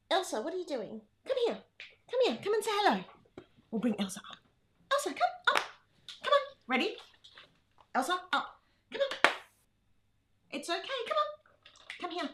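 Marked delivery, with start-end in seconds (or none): 0.1-3.0 high-pitched pet-calling voice; coaxing a pet. Real, not acted. Host calls her dog Elsa over, high and coaxing. 4.9-12.3 very high, short encouraging calls; coaxing a pet. Real, not acted. Host urges Elsa to jump up, high-pitched coaxing.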